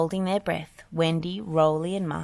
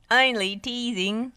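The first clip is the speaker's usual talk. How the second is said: laughing; 6 semitones higher, 5 dB louder than usual. A narrator's reading. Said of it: "laughed Spud".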